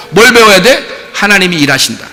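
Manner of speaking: ordinary delivery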